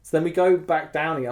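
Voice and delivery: ordinary talk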